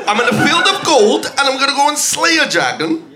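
put-on high, insistent Geordie accent. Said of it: "I'm a Geordie".

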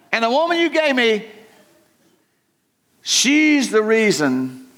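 high-pitched, petulant Adam passing the blame; whiny Adam. Acted. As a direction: whine it like a man pointing at someone else. Preacher acts out Adam blaming God.

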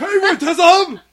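high laughing shout; mock outrage. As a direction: cry foul while laughing.

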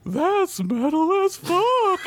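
very high, slow little voice; quiet Toadstool voice. Acted. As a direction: murmur it in a tiny high voice. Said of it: "Toadstool said quietly".

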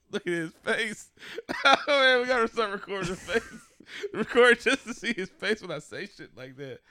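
high, laughing voice. Real, not acted. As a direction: crack up while talking. Laughing at his face.

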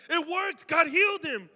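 high, loud imitation of thrilled students; excited announcement. Students tell their pastor of a healing, high and thrilled. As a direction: shout the amazing news.

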